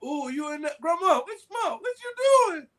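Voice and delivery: put-on high, eager child calling out; excited kid voice. Child smelling grandma's cooking, calling out high and eager. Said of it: "and you're like".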